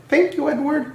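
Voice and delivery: high grateful carriage voice; coaches' voice. A narrator's reading. Coaches thanking Edward, high and grateful. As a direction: thank him in a high sweet voice. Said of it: "said the coaches".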